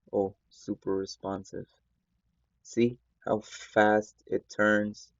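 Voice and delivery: usual speaking voice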